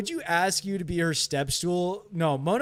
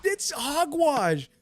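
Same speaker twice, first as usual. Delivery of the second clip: shocked outrage; 12 semitones higher, slower than usual. Real, not acted. Streamer yelling after a creeper blows up, high shocked shout. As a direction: shriek in disbelief at the explosion.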